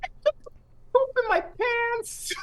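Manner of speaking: put-on very high, slow silly child voice; giggly five-year-old confession; a tiny child on a game headset, very high and slow; little kid voice. Five-year-old niece taunting gamer.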